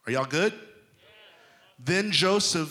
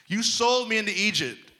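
higher, louder accusation; accusing; 7 semitones higher, 5 dB louder, faster than usual. Acted. Joseph confronting his brothers, loud accusing. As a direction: point the finger hard. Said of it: "He didn't say".

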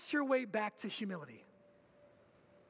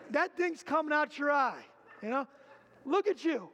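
louder, animated sermon voice; emphatic preaching; 4 semitones higher, 4 dB louder than usual. Acted. Pastor mocks the plank in your own eye, animated preaching. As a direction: paint the picture with energy.

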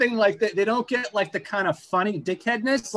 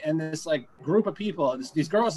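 usual speaking voice

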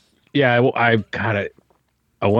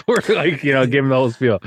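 ordinary talk, nothing marked

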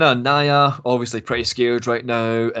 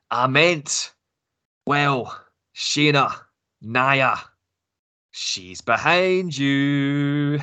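put-on higher-pitched Glaswegian accent, drawing out the last word; Nikki Cross's Glaswegian accent; 4 semitones higher, slower than usual. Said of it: "in her Glaswegian accent".

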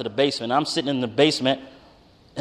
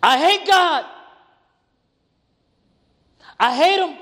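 much higher and louder, cry it out in pain; anguished outburst; 14 semitones higher, 5 dB louder than usual. Retold or imitated. Son blurting to his father that he hates God, anguished cry. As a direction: let the hurt burst out of you. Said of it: "it came out more like".

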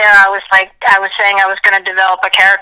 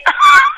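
high shrieking burst of laughter. Real, not acted. Laughing at the joke.

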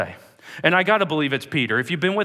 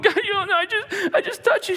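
very high, whimpering plea; acted tearful panic. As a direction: blurt a frightened confession. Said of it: "She comes stumbling".

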